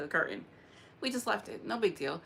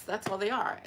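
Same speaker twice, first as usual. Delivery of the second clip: ordinary talk, nothing marked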